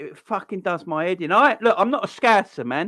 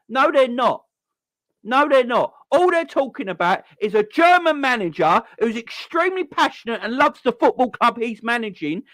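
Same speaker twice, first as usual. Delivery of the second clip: rising pitch, getting louder and angrier; heated rant; 6 semitones higher, 4 dB louder than usual. Real, not acted. Ranting at the media over the Klopp coverage, heated and loud. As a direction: let the outrage build up.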